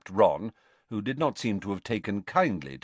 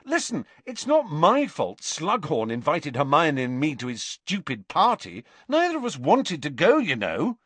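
heated, higher-pitched protest; indignant, pitch jumping on stressed words; firing up; 7 semitones higher than usual. A narrator's reading. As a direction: defend yourself with rising indignation. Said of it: "firing up".

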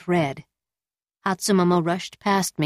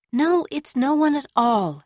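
coy woman voice; 6 semitones higher than usual. A narrator's reading. Woman hiding her lover's suspicion.

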